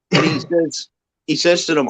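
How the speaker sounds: ordinary talk, nothing marked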